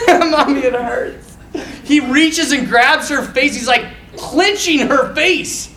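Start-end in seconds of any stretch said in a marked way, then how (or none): 0.1-1.4 high, hammed-up child's complaint; mocking cry to mommy; mock little-boy whimper. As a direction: play a hurt kid, half laughing. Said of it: "Not like".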